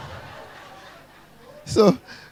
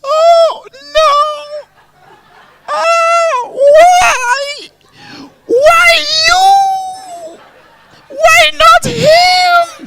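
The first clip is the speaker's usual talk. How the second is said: very high, loud mock wail; hammed-up crying; 6 dB louder, much slower than usual. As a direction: wail in exaggerated grief, drawing out each word. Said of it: "I was crying".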